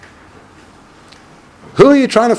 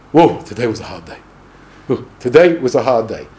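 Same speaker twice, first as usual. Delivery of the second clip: mock weary complaint; 10 semitones lower than usual. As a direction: sigh it like you've toiled all day. Lazy man complaining at supper.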